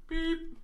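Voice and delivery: high electronic beep. Retold or imitated. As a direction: chirp it like a machine. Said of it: "the tinny voice through the hatch".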